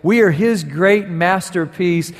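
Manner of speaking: impassioned preaching. Real, not acted. Preacher telling the church they are trophies of grace, impassioned. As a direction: preach it out to the whole congregation.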